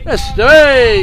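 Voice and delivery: high, loud cheer; cheering shout. Real, not acted. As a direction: yell it out in delight. Cheering a big six.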